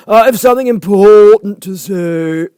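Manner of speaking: high, loud, slow; calling out. A narrator's reading. Caterpillar calls after Alice, loud and slow. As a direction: call it loudly across distance. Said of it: "the caterpillar called after her".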